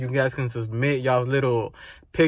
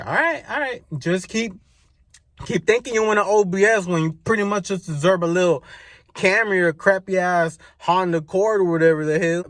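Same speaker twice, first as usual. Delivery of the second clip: sarcastic rant; 5 semitones higher, 4 dB louder than usual. Retold or imitated. Ranting retort to OBS wannabes.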